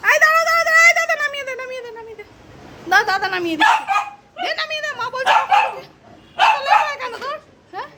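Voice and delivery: high, sing-song coaxing to the cat; baby talk to a pet. Acted. Voicing the cat's wishes. (বিড়ালকে উঁচু আদুরে গলায় থামানো)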